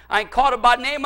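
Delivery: normal talking voice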